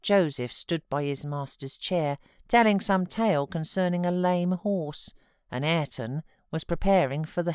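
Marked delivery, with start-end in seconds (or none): none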